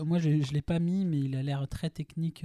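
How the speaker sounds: normal talking voice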